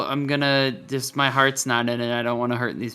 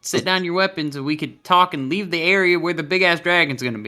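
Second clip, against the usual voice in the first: pleading; 2 semitones higher, 4 dB louder than usual. Acted. Pleading with the enemy.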